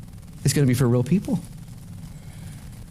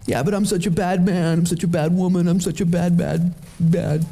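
higher, monotone mock lament; self-condemning whine; 7 semitones higher than usual. Sinner protesting to God, mock self-pitying whine. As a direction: wallow in it, repetitive and pitched up.

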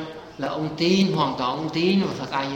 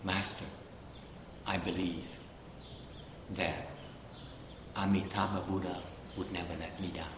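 softer, lower, reverent plea to the master; quiet devout quote; 6 semitones lower, 13 dB quieter than usual. Retold or imitated. Preacher quoting the dying man's trust, soft and reverent. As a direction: hush it like a humble believer. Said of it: "He said".

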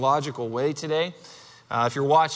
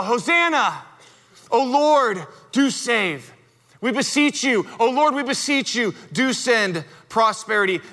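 higher, ringing cry of the crowd; crowd yelling; 4 semitones higher than usual. Retold or imitated. Preacher voicing the crowd yelling hosanna, raised and ringing. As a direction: call it out like a festival crowd. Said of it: "And so they yell".

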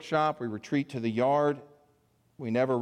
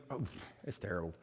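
choked up; 6 semitones lower, 12 dB quieter, faster than usual. Real, not acted. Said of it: "why I'm so emotional".

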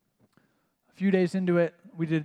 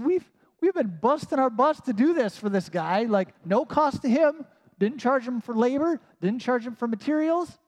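higher, aggrieved complaining; retold indignation, higher pitch; indignant rant; 7 semitones higher, 3 dB louder than usual. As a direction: list the grievances, fed up. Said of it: "I was ticked".

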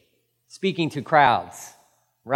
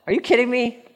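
incredulous; 8 semitones higher, 3 dB louder, faster than usual. Acted. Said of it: "I love that".

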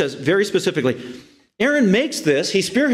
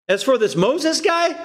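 high, raised mocking quote; scornful crowd member, pitched up; dismissive mockery; 9 semitones higher than usual. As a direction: throw out the name with scorn.

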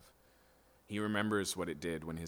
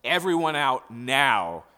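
loud, slow, strained command; a man about to break, ordering the room empty; choked-out cry; 4 semitones higher, 11 dB louder, slower than usual. Acted. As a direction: force the words out through tears. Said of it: "all he can choke out is".

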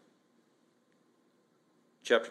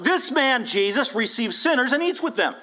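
high, louder outraged accusation; loud grumbling; 9 semitones higher, 4 dB louder than usual. Retold or imitated. Preacher voices Pharisees despising Jesus before the crowd, loudly indignant. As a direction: voice open contempt so the crowd hears. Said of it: "grumbling loud enough to hear them".

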